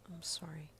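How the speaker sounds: soft, barely voiced sorry; whispered apology. A narrator's reading. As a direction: whisper it with head down. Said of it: "she whispered".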